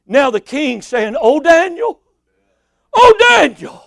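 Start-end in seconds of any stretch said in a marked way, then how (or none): 1.2-3.8 mock-lamenting call, climbing higher on the repeat. King calling to Daniel at the den, acted lament. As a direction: cry out the name like a grieving king. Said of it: "with a lamentable voice".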